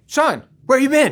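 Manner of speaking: a careless coach, surprised; coach calling out. Retold or imitated. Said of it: "and he's like".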